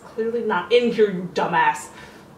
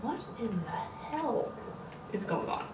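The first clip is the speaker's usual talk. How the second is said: hushed fear; 13 dB quieter than usual. Real, not acted. Scared in dark house.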